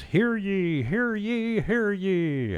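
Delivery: high, loud proclaiming cry; town crier. Pretend. Radio host reading a notice aloud, high town crier call. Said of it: "I feel like the town crier".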